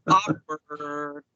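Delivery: low, drawn-out monotone; comic awkwardness. Acted. Host commenting on an uncomfortable encounter, comically awkward. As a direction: stretch it out low for comic effect. Comic verdict on the bed scene.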